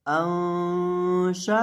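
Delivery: अंशा chanted very slowly with a nasal hum on the noon; drawn-out recitation. Shown as a demonstration. Teacher reciting the joined syllables for students, slow chanted ghunna. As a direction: hold the noon of अंशा in a long sung ghunna.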